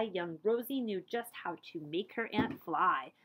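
ordinary delivery, nothing marked

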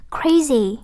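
CRA-zy, first syllable stronger; stress on first syllable. Shown as a demonstration. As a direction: say "Crazy" with the beat on CRA. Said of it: "âm một". (nhấn âm đầu của "Crazy")

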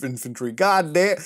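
ordinary delivery

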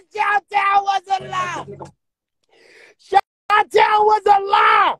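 high, slow shrieking mock wail; shrill slow mockery of his complaint; mocking echo. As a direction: throw his words back in a screeching parody. Mocking the crying rapper's line.

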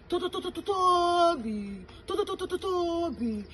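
high, sing-song stutter into the name Tobi; playful name call. Real, not acted. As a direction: stretch "to, to, to" up high before landing on "Tobi".

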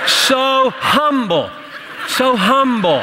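high, drawn-out mock admiration. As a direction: gush in jest, stretching the words. Joking about a sanctified cat.

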